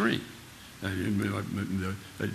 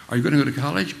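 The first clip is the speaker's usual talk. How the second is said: higher, louder questioning adult; quizzing teacher; 5 semitones higher, 6 dB louder, much faster than usual. Retold or imitated. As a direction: press the boy with the question. Retold teacher quizzing Jimmy.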